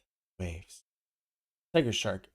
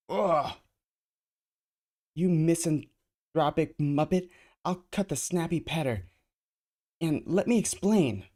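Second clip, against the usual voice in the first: high, loud angry voice; angry heroine; 6 semitones higher, 5 dB louder than usual. Acted. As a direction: snap at him angrily. Ms. Marvel angry at Beast.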